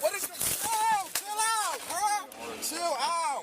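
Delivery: high, strained pleas to calm down; begging the cop to chill out; panicked pleading. Real, not acted. Man panicking during arrest.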